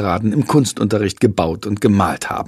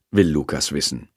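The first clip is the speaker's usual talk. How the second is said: ordinary delivery, nothing marked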